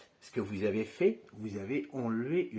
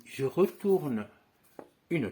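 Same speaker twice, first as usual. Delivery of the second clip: "je retourne" drawn out slowly and a bit louder; slow motion; 5 semitones higher, 6 dB louder, slower than usual. Shown as a demonstration. Magician slowing down "je retourne" for students watching the move. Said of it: "je le fais en ralenti".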